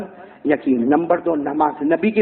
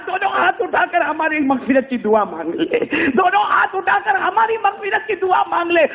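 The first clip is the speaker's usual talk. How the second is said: tearful plea; 9 semitones higher than usual. A narrator's reading. Ali begging Owais for prayer.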